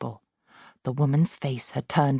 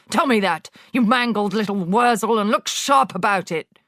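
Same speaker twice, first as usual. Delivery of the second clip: much higher, louder, contemptuous tirade; ranting headmistress; 7 semitones higher, 4 dB louder than usual. Acted. Headmistress berating Wilfred, loud and scornful. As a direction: sneer and hammer every insult.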